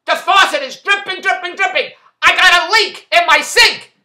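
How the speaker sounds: high, loud complaint; calling out. Retold or imitated. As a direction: keep shouting down to the front desk. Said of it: "I called out at the front desk".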